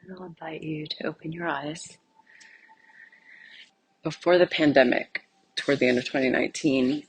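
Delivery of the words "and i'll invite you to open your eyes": very soft, hushed meditation voice; near-whispered invitation; guided meditation. Acted. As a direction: ease them gently out of silence. Closes the silent meditation.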